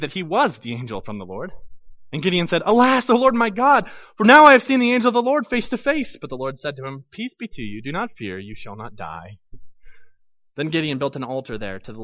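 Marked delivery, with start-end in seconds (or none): 2.7-6.2 alarmed cry. Acted. Gideon cries out to God. 7.1-9.4 calm reassurance. Acted. The Lord calms frightened Gideon.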